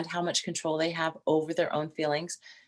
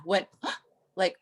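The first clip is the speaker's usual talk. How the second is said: ordinary delivery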